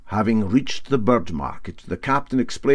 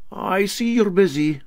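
higher, polite apology; lightly voiced captain; 10 semitones higher than usual. Captain apologising to the riveter, higher and polite. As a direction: back out politely.

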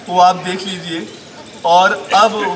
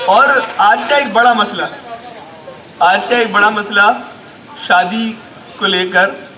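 ordinary delivery

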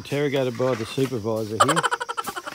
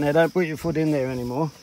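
stern warning; 4 semitones higher, 7 dB louder than usual. Real, not acted. Warning child away from mud.

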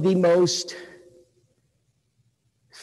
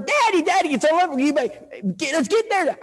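put-on high excited kid voices calling daddy; eager kids; 11 semitones higher than usual. Kids urging their dad to the store, high and eager.

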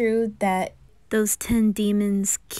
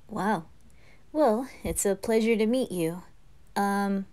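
normal talking voice

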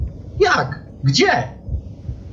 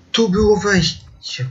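higher, struck by realising it; surprised realisation; 7 semitones higher than usual. Real, not acted. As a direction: say it as it dawns on you. Realises the entrance was here.

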